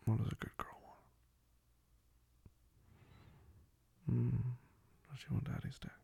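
breathy teasing whisper. Acted.

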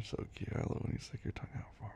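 quick, soft whisper. Real, not acted. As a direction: whisper it close. Unvoiced fast whisper.

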